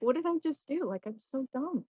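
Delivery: self-reproach. Retold or imitated. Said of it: "was like".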